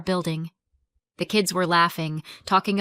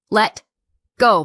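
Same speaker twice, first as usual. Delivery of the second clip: firm; 2 semitones higher, 9 dB louder than usual. A narrator's reading. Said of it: "Madison's voice didn't rise".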